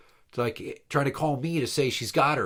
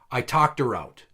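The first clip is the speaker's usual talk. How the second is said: ordinary talk, nothing marked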